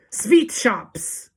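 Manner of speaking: put-on high, loud accented witch shout; Grand High Witch shout. A narrator's reading. Witch leader revealing what to buy, loud shout. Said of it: "shouted the Grand High Witch".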